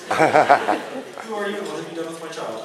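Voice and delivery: slow, laughing, low mock-stunned parent; mock-shocked parent. Parent answering the child who says love you, slow and laughing. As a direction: play the stunned parent, slowly, through a laugh.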